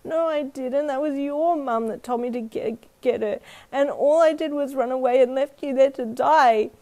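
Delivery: put-on high, loud, stammering child's wail; bawling. A narrator's reading. Boy blaming himself to his friend, bawling. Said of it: "He started bawling again".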